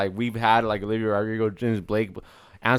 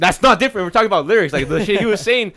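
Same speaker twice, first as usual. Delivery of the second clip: heated; 8 semitones higher, 8 dB louder than usual. Real, not acted. Heated disagreement.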